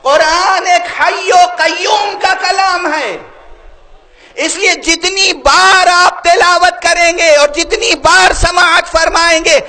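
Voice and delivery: high, slow, swelling sermon oratory; full-force preaching. Real, not acted. Preacher proclaims the living Quran to the congregation, full-force preaching. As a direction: build to a ringing climax that calls for response.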